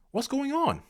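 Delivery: a comic high-pitched wail of disbelief; exasperated squeak. Acted. Exasperated at the team's form.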